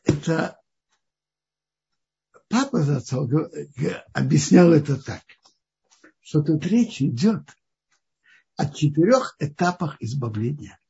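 ordinary talk

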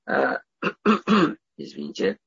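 ordinary talk

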